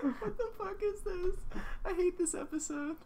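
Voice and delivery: very high, squeaky words through laughing; real cracking up while talking; helpless laughter. Real, not acted. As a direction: wheeze it out, nearly crying with laughter. Helpless laughter after silly yelling.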